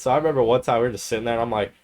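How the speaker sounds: ordinary talk, nothing marked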